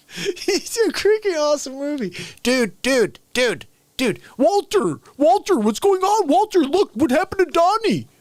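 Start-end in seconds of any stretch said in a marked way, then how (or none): 2.4-8.1 higher, pleading calls to Walter; frantic Dude. Retold or imitated. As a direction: whine for Walter in a panic. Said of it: "everybody from the movie".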